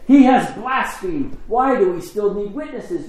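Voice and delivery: high, slow and loud indignant cry; a priest scandalised before the council; outraged high priest. Acted. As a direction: tear into the word blasphemed in outrage. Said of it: "tore his clothes and said".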